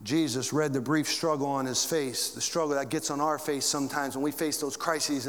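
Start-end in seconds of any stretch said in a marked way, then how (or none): none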